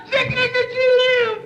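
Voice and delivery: put-on French accent, cry out through happy tears; crying with joy. Cricket turns out alive.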